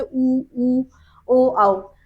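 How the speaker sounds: rounded vowels ऊ, ओ, औ said with clearly rounded lips; vowel demo. Shown as a demonstration. Teacher demonstrating rounded vowels to students, lips rounded and distinct. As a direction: model ऊ, ओ and औ one by one for the learners. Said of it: "आप इनका उच्चारण करिए".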